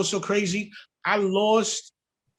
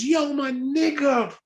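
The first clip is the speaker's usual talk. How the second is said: animated greeting; 8 semitones higher, much slower than usual. Retold or imitated. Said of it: "first thing he said out his mouth was".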